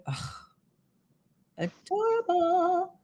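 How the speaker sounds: ordinary talk, nothing marked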